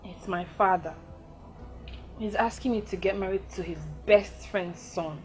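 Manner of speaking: troubled confession. Acted. Breaking bad news to lover.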